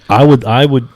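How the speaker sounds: sudden high, loud outburst; really loud. Real, not acted. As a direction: blurt it out way too loud. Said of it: "I got really loud".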